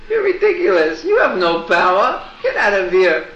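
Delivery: high, amused brush-off; laughing dismissal. Acted. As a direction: shoo him away laughing. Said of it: "Krishna just laughed".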